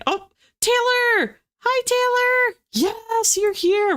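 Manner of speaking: high, drawn-out happy hello; excited greeting. Real, not acted. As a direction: call out to a friend arriving. Greeting a viewer who joined.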